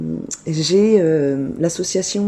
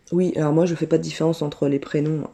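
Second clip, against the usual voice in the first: ordinary talk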